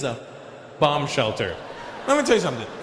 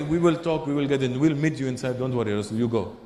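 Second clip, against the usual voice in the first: mock Lebanese English, falling lower at the end; Lebanese accent; 3 semitones lower than usual. Friends sending the visitor ahead, Lebanese accent. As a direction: brush the worry off in a Lebanese accent.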